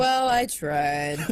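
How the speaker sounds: lightly voiced derp, low and slow; a dopey, drawn-out shrug of a voice; low, slow derpy delivery; derp clone.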